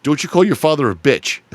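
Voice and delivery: high, loud mock-stern dad; hammed-up parental rebuke; stern scolding. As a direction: scold him like an offended father. Father scolds son in retold story.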